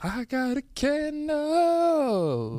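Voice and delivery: silly high voice. Pretend. Silly put-on voice.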